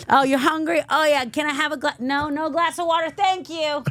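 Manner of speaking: high, put-on sarcastic politeness; mock gushing sarcasm. Host acting out being refused a glass of water, mock gushing. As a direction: pile on the fake thanks, pitched high.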